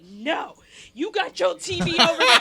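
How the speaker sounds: loud, high laughing scold; childish squabble. Retold or imitated. Passenger fighting the toddler over the seat remote, loud and childish. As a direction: bicker like a small child.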